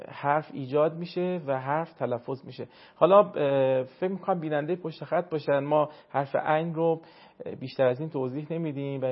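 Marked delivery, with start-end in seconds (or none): none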